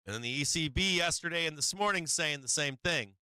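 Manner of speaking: usual speaking voice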